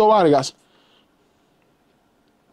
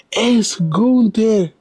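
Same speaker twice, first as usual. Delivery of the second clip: drawn out very slowly and much higher; stunned reveal; 5 semitones higher, much slower than usual. Real, not acted. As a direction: stretch the name out as if you can't believe it. Names the man in shock.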